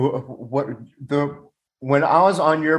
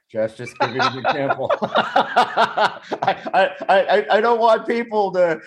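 talking through laughter, pitched higher; laughing; 6 semitones higher than usual. Real, not acted. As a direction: try to get the words out while laughing. Laughing at his bad breath joke.

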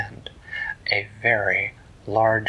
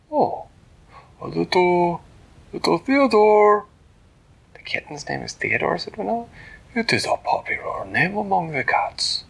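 higher, formal and polite small hero; Flippertigibbet voice; 9 semitones higher, 4 dB louder than usual. Acted. Flippertigibbet greeting the turtle, high and polite.

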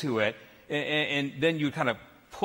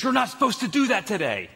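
higher-pitched imitation of a strict babysitter; mock scolding; 6 semitones higher than usual. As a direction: lightly act out an alarmed reprimand.

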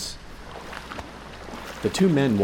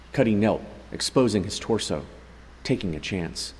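ordinary delivery, nothing marked

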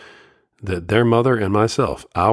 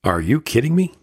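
higher pitched disbelief; scoffing, higher, can't believe it; incredulous; 6 semitones higher than usual. Real, not acted. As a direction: ask it as if the idea is absurd. Scoffs at fathers he just quoted.

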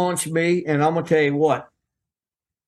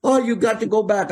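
imitating a panicked agent, higher pitched; mock alarm in a higher voice, "what are you doing"; 7 semitones higher than usual. Agent Pat insists he return.

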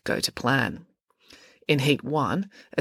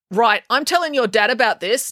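raised, indignant threat; indignant declaration; 6 semitones higher, 3 dB louder than usual. A narrator's reading. Constable Pike threatens to tell April's dad, indignant. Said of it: "declared Constable Pike".

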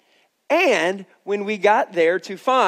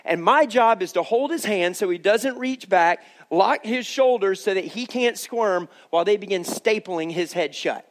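ordinary talk

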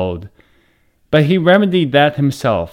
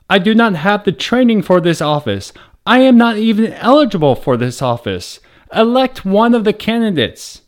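protesting; 7 semitones higher than usual. A narrator's reading. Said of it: "the governor protested".

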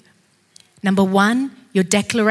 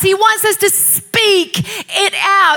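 high, slow, forceful exhortation; full-voiced pulpit urging; impassioned preaching; 9 semitones higher, 5 dB louder, slower than usual. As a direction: stretch the key words and drive them home.

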